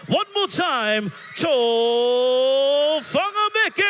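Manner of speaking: announcer call. Real, not acted. MC announcing the headline act.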